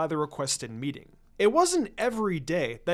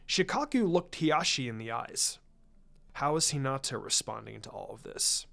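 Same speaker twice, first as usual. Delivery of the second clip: usual speaking voice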